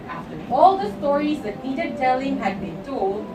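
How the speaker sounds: ordinary delivery, nothing marked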